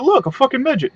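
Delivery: sudden high-pitched gawking shout; mock excited exclaim. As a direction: pitch jumps way up on Look. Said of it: "and I just went".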